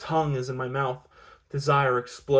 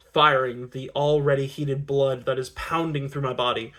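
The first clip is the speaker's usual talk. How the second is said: usual speaking voice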